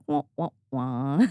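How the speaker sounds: slow, deflated trombone imitation; comic "womp womp" noise; mock sad-trombone wah. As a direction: draw out each wah like a letdown jingle. Imitates a letdown sound for dud episode.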